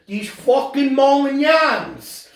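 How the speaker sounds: high, slow, emphatic grumble; hammed-up indignant old-neighborhood voice; mock Italian-American griper. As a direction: draw it out in put-on disgust. Mimics bigoted Italian-American.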